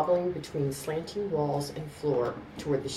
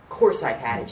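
loud, tipsy slurred retort; 3 semitones lower, 7 dB louder than usual. A narrator's reading. Tipsy woman insists she had her purse, slurring loudly. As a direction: slur it out loudly.

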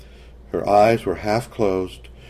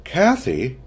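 torn voice; 6 semitones higher than usual. A narrator's reading. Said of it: "a voice that sounded torn by claws".

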